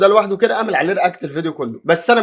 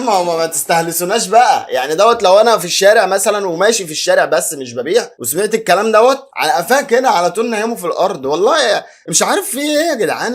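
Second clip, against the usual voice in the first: raised, heated rant at full volume; loud ranting; 3 semitones higher, 5 dB louder than usual. Real, not acted. Ranting at viewers about the vendors, loud and heated. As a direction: shout it out as if fed up with everyone.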